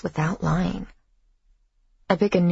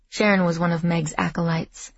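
ordinary delivery, nothing marked

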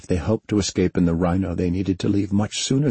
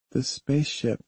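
put-on soft, dazed young girl; small child, barely above a murmur; high, quiet little girl; 8 semitones higher, 5 dB quieter than usual. A narrator's reading. Jamie looks around, dazed.

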